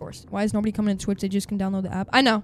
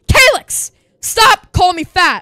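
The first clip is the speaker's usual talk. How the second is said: much higher and louder protest; scolding shout; 9 semitones higher, 11 dB louder, slower than usual. Real, not acted. Streamer telling off a chatter who teased him, indignant shout. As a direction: yell at a teasing chatter to quit.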